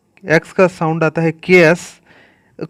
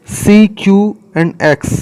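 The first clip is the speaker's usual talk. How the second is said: slow letter naming; 4 semitones higher, 4 dB louder, much slower than usual. Shown as a demonstration. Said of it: "teacher names letters slowly".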